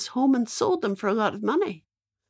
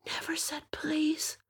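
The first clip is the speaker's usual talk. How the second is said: slow, higher, whispered aside; shocked whisper; 8 semitones higher, 8 dB quieter, slower than usual. A narrator's reading. Storyteller telling children Michael was rude, shocked whisper. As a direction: gasp then whisper the scandal.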